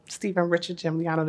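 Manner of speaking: ordinary talk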